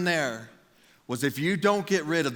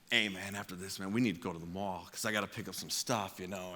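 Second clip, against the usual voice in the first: low, soft, casual murmur; friend's hushed aside; 6 semitones lower, 10 dB quieter than usual. Retold or imitated. Friend nudging him in chapel about the mall, hushed. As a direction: lean over and talk under the sermon, low.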